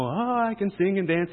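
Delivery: high, airy burst of mock elation; emotional gushing. Preacher mimicking emotion-only relief, mock gushing. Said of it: "not just the emotional".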